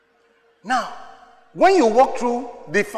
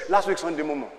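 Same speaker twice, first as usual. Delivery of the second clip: ordinary delivery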